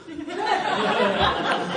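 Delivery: a high burst of real laughter. Real, not acted. Storyteller cracks up at his own stunt, laughing hard. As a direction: break out laughing at your own crazy plan.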